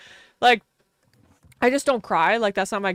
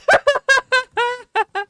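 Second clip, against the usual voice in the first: squealing laugh, high and loud; high burst of laughter; 14 semitones higher, 7 dB louder than usual. Real, not acted. Laughing hard at the Shih Tzu line. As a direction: cracks up at her own dog joke.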